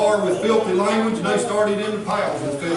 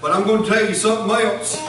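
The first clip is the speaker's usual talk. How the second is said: normal talking voice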